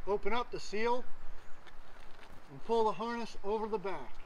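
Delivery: muffled behind mask filter; through a gas mask. Real, not acted. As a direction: voice inside sealed respirator. Seen 0: Full-face gas mask worn over mouth throughout labelled words.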